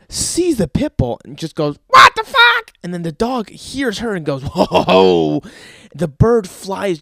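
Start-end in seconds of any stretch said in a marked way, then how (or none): 1.9-2.7 loud, very high squawking bird; startled bird. Acted. Bird spots the pit bull, high startled squawk. 4.4-5.4 loud drawn-out whoa as the dog; dog's reaction. Acted. Dog hears the bird, loud booming whoa.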